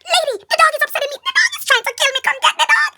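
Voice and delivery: very loud, high, fast scream at the owner; terrified yell. Retold or imitated. Chased girl screaming at the dog's owner, very loud and high. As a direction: scream back in terror.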